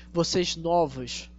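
usual speaking voice

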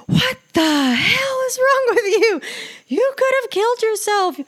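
very high, loud screech of outrage; losing it. Retold or imitated. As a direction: scream at the reckless driver in full hysterics. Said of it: "I mean, I just lost it".

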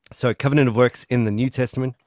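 normal talking voice

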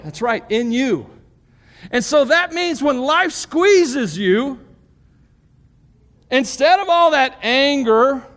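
high, loud preaching voice driving each point home; full-force preaching. Acted. Pastor preaching the fruit of the Spirit, at full force. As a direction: preach it out big to the whole room.

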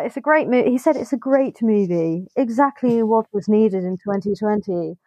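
a hint of an Irish English accent. Reading a listener's comment in a light Irish English accent. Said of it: "That was an authentic Ian accent".

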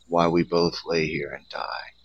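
whispered, fading last words; dying whisper. Acted. Snake tells the boy they both will die, whispered. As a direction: let it trail off into a whisper.

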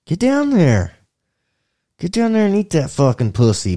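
high, loud pretend order; hammed-up bossy command; mock commanding. Acted ordering of the caught man.

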